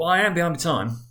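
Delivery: a flustered clerk begging pardon; nervous clerk. Acted. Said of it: "said Bob".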